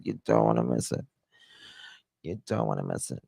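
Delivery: low goofy "you don't wanna miss it"; comic low, fast voice; deep joke voice. Pretend. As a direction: drop into a silly deep voice.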